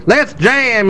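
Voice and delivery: high mock singer's shout-out; rally the band, imitating her; rousing rocker. Imitating the singer switching to electric guitar, rousing. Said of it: "and she goes".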